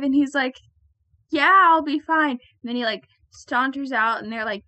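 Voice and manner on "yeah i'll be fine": louder, higher mock assurance; loud brush-off. Imitating ad's drinker to friends, loud brush-off. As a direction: imitate a cocky insistence.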